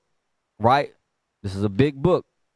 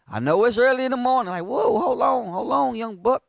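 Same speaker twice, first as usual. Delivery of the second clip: startled listener; 8 semitones higher than usual. Retold or imitated. Mock reaction of a startled listener.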